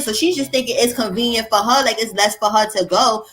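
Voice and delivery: normal talking voice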